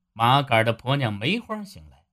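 ordinary delivery

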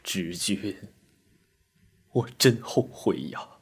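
soft, grief-stricken lament with long pauses; subdued tearful voice; weeping. A narrator's reading. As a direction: mourn quietly through tears, full of remorse. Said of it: "哭道".

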